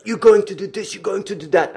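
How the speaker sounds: high, loud mocking yell at the worker; retold yelling. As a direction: shout it like an angry boss. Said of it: "the guy starts to yell at me".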